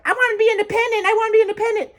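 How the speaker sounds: very high, put-on mimicking voice; mock them in a tiny high-pitched voice; mocking whine.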